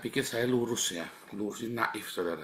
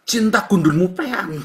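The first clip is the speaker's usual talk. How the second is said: mock outburst; 9 semitones higher, 9 dB louder than usual. Mocking the loving hypocrite.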